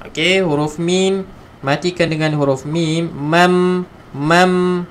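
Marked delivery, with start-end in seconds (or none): none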